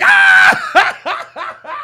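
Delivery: very high, loud yell into laughter; mock scream. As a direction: imitate a scream, then crack up. Imitating friend, cracking up.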